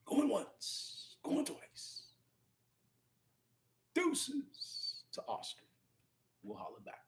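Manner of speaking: hushed auctioneer sign-off; whispering. Acted. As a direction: murmur it like a fading auction call. Auction-style signing off.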